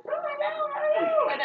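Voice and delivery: imitating a super high-pitched helium squeal; squeaky helium voice. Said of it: "she said in her super high-pitched".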